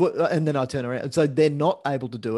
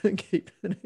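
laughing; 5 semitones higher than usual. Real, not acted. Laughing about radiographer.

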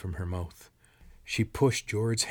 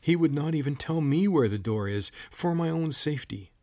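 higher, louder frustrated outburst; shaking with frustration; 7 semitones higher, 4 dB louder than usual. A narrator's reading. Old woman explaining her husband's secrecy, frustrated. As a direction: let frustration push the voice up. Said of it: "Her voice shook with frustration".

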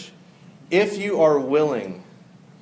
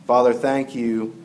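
prayer; 5 semitones lower, 4 dB quieter than usual. Acted. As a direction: pray quietly to the Lord. Closing prayer.

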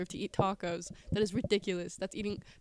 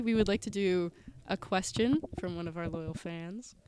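usual speaking voice

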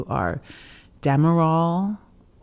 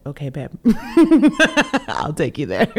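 laughing; 3 semitones higher, 6 dB louder than usual. Retold or imitated. Husband giving in, laughing.

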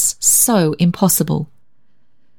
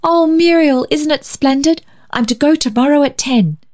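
excited; 9 semitones higher than usual. A narrator's reading. Girl bubbling with news.

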